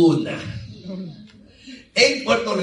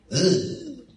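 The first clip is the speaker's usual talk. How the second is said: disgusted ugh; 5 semitones higher than usual. Acted. Imagining carpet of frogs.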